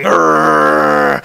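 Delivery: long, low straining growl. Acted. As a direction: growl while locked in a struggle.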